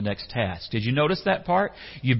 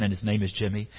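usual speaking voice

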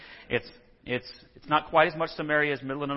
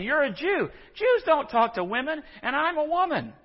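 indignant Samaritan woman; 13 semitones higher than usual. Retold or imitated. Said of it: "She's saying".

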